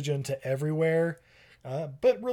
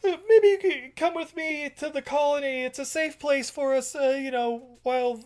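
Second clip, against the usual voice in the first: much higher, with a laugh in it; high girly voice; 11 semitones higher than usual. Retold or imitated. Claire inviting the captain to the colony, high and laughing. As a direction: sweetly invite him along.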